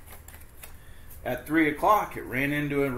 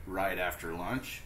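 ordinary delivery, nothing marked

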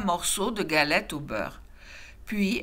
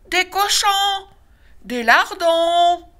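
mock cubs' answer; 10 semitones higher, 7 dB louder, slower than usual. A narrator's reading. Cubs answer papa.

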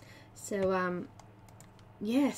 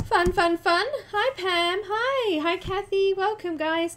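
excited; 8 semitones higher, 5 dB louder than usual. Real, not acted. Cheery greetings to viewers.

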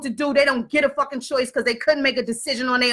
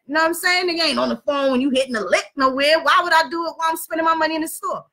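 ordinary talk

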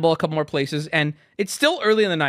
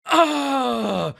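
long, high groan sliding down; pained groan; 6 semitones higher than usual. Retold or imitated. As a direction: imitate someone groaning in agony as they sit. Imitates friend sitting down in pain.

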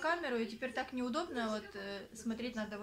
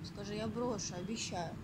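usual speaking voice

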